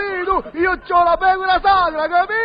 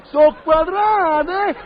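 slow outraged; much slower than usual. Acted. Outraged conclusion.

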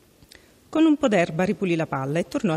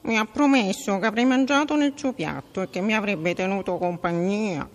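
frog voice; 4 semitones higher than usual. A narrator's reading. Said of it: "ma il ranocchio".

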